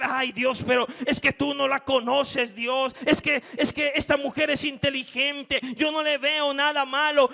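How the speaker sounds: pleading believer. Acted. Believer pleading with God about the woman, mock whine. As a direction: argue back like a stubborn child.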